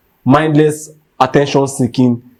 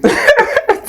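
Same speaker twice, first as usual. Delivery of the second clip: brief laugh. Real, not acted. Host laughs again.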